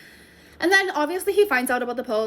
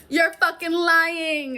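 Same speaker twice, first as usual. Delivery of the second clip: slow, drawn-out, louder and higher; stunned disbelief; 6 semitones higher, 4 dB louder, much slower than usual. Real, not acted. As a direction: spell out each word in shock. Disbelief at the Air Canada charge.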